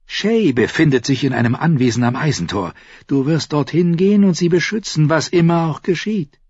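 Tyrion's voice. Acted. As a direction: pitch up into Tyrion's quick voice.

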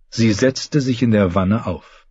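plain reading voice

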